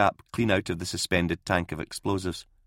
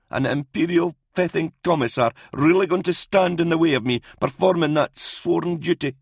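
put-on higher, emphatic old doctor's voice; indignant old doctor; 8 semitones higher, 3 dB louder than usual. Old doctor challenging commissar over sworn duty, indignant. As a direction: press the challenge with rising indignation.

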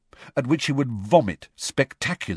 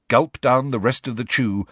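ordinary delivery

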